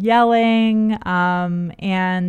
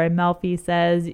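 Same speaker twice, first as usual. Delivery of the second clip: ordinary delivery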